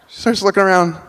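ordinary talk, nothing marked

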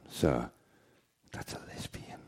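hushed, unvoiced aside to the customer; whispered bartender. Bartender tells the customer in a whisper. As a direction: drop to a breathless whisper.

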